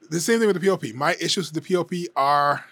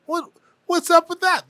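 baffled; 13 semitones higher than usual. Real, not acted. Baffled by the odd AI song.

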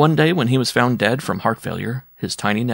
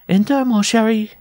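higher, coaxing call to a lover; coy giantess; 13 semitones higher, 4 dB louder than usual. Acted. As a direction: call sweetly to the man you expect. Giantess flirts with expected lover.

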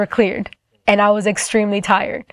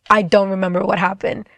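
ordinary talk

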